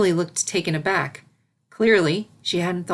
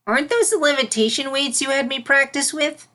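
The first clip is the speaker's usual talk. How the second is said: puzzled filly; 8 semitones higher, 3 dB louder than usual. Acted. Filly questioning mentor.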